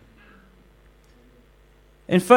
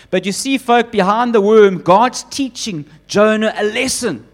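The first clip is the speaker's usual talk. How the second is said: ordinary talk, nothing marked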